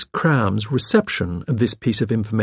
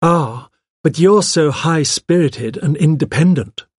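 mock-grave compliment, pitched higher; 6 semitones higher, 4 dB louder than usual. A narrator's reading. Wife flattering the secretary, gravely. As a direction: flatter her with a solemn air. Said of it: "said Griselda gravely".

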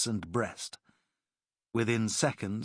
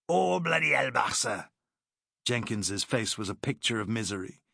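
cheeky Welshman; 3 semitones higher than usual. Acted. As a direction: play Jenkins as a chirpy Welsh comrade. Jenkins pleads.

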